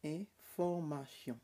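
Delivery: "Information" said slowly and carefully; slow model word. Shown as a demonstration. Teacher modelling "Information" slowly for students. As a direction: stretch out "Information" for the class. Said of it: "model spelling then word".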